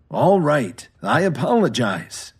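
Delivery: ordinary delivery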